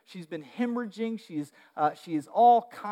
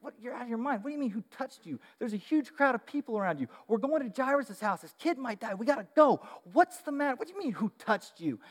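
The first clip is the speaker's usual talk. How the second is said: higher-pitched, disbelieving friends; exasperated friends; 5 semitones higher than usual. Retold or imitated. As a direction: play the baffled disciples pushing him on. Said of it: "Jesus' friends are like".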